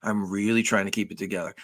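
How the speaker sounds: quiet, pleading, on the edge of losing it; acting upset. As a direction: play it like he's barely holding it together. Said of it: "I kinda do a little acting".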